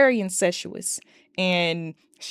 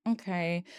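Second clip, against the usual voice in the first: deflated sigh; 8 dB quieter than usual. Real, not acted. Awkward reaction to Nikki.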